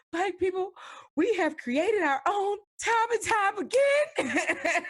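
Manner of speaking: high, slow, laughing with pride; proud laughing quote. Retold or imitated. Friend retelling her proud cry at the film, laughing. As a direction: gush it out through a laugh. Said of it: "I was just like".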